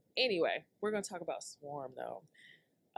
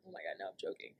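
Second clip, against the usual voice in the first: whispering; 9 semitones lower, 8 dB quieter, much faster than usual. Real, not acted. Whispers aside.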